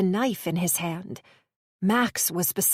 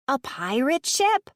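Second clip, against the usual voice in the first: put-on higher, bright-eyed child's question; a girl thrilled by a pirate ship; excited little girl; 6 semitones higher than usual. Little girl answering the bandit, lightly voiced child delight.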